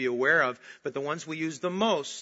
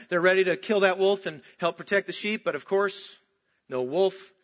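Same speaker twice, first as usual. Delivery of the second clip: ordinary delivery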